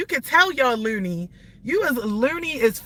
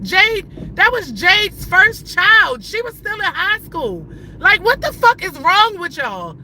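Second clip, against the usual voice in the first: high, heated indignant protest; real outrage, pitched well up; exasperated rant; 6 semitones higher, 4 dB louder than usual. Real, not acted. Defending little sister against online harassers, real exasperated anger.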